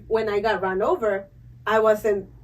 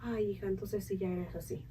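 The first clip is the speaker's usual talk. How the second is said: a lower, softer motherly tut; mom's voice; 3 semitones lower, 16 dB quieter than usual. Retold or imitated. Imitating her mom's excuse about her falls, lower and soft. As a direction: quietly imitate a mother clucking over her.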